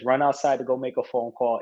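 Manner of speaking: ordinary talk, nothing marked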